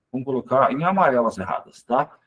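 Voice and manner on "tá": usual speaking voice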